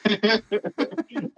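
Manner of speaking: a real amused chuckle; laughing at the memory. Real, not acted. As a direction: laugh as you recall his eagerness.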